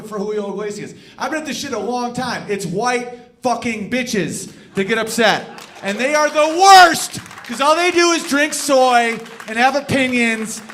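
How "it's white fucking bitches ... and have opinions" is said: loud comic rant; ranting yell. Real, not acted. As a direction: shout it out angrily.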